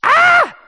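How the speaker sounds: piercing, very high shriek; toddler's scream. Retold or imitated. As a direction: imitate a screaming toddler, loud and sudden. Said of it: "this is how he starts screaming at me".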